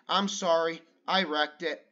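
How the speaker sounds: ordinary delivery, nothing marked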